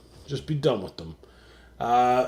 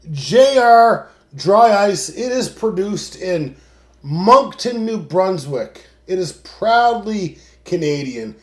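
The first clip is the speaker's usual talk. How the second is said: ad read; 4 semitones higher, 5 dB louder, slower than usual. Acted. Ad read of sponsor.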